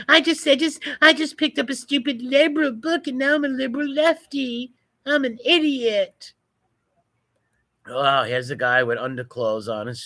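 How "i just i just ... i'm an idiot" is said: high, fast whiny mockery; mocking impression. Voicing a laughing protester to mock her, high whiny voice. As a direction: squeak it out like a silly fool. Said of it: "Look at her laughing, like".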